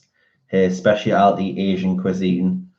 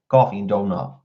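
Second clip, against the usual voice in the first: put-on New York accent; 3 dB quieter than usual. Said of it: "I'm not very good with New York".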